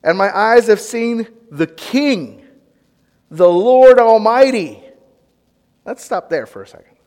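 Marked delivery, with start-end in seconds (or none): 0.0-4.8 slow, loud, higher cry of dread; terrified. Acted. Preacher voicing Isaiah's dread, slow loud cry. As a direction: wail it like a doomed man. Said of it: "Then he says".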